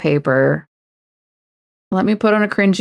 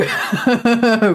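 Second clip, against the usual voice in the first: laughing; 5 semitones higher than usual. Real, not acted. Streamer tickled by their own awards bit, laughing. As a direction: laugh breaking out mid-bit.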